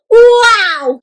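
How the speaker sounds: high, loud, drawn-out cheer; big thrilled exclamation; excited and loud. Shown as a demonstration. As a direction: shout it with delight. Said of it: "very excitedly and loud".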